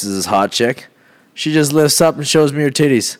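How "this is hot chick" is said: ordinary talk, nothing marked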